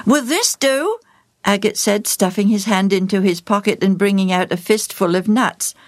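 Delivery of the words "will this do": put-on child voice. A narrator's reading. Child voice in story.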